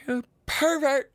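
high, slow teasing scold; mock scolding. Teasing a listener named Trevor, mock scolding. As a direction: tease him like a scandalised aunt.

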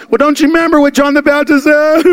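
high, loud, stretched-out whine; mock whining protest. What Jesus didn't say on the cross, high mock whine. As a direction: wail it out as a mock complaint.

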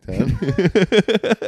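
stretched out slowly, savoring it; slow drawn-out. Acted. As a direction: draw every word out lazily.

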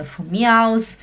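ordinary talk

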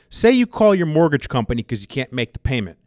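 usual speaking voice

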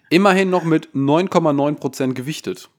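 ordinary talk, nothing marked

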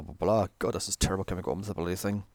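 ordinary talk, nothing marked

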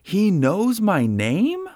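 put-on high, loud, slow, amazed child's shout; little boy shouting in happy amazement; shouted with delight. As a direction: burst out in thrilled disbelief. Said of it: "stopped dead in his tracks and shouted".

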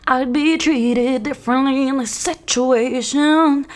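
high, slow, drawn-out protest; theatrical indignation. Retold or imitated. Ranting at housemate over unfair treatment, theatrical indignation. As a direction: wail it in exaggerated outrage. Hammed-up rant retold.

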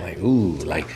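low, soft mock smooth talker; suave impression. Host teasing guest about how he talks, mock suave. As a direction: imitate a suave charmer, dropped low and quiet. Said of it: "like Don Suave".

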